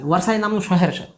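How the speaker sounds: ordinary talk, nothing marked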